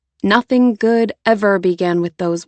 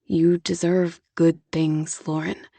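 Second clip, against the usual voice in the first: lower, softer, patient man soothing her; Jonah, patient and gentle; 3 semitones lower, 4 dB quieter than usual. Acted. Jonah coaxes Lauren back into his arms, low and soft. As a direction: coax her back softly, low and calm.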